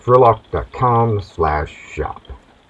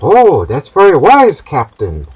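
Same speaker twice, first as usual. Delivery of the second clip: put-on higher, gushing young sailor; admiring cabin boy; 4 semitones higher, 4 dB louder than usual. Cabin boy praising the captain's wisdom, high and impressed. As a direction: flatter the captain like a wide-eyed boy.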